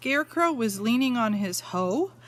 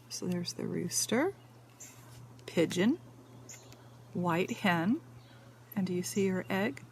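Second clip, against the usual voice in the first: whisper; 3 semitones lower, 6 dB quieter than usual. Real, not acted. Asks child about egg.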